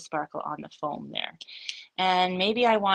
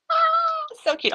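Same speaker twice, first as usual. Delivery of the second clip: high squeal of delight, louder; 6 dB louder, slower than usual. Real, not acted. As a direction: squeal at how cute it is. Gasps at sparkly painted result.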